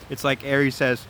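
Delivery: ordinary delivery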